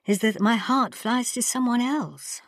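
miserable. Acted. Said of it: "Ping Cho said miserably".

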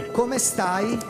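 normal talking voice

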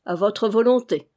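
plain reading voice

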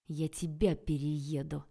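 slow hissed threat to a brother. A narrator's reading. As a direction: hiss it out as a mock threat. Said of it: "прошипела я".

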